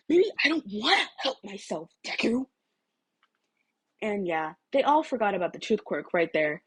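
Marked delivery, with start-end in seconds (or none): 0.1-2.5 higher, sneering; a hint of a sulky sneer; lightly sneering Bakugo.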